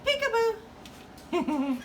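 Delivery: slow drawn-out high peekaboo. Real, not acted. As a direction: stretch it out teasingly. Peekaboo with bird.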